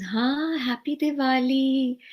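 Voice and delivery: high, slow and joyful greeting; Guruji's greeting. Retold or imitated. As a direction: stretch out a delighted happy Diwali. Guruji greets her warmly.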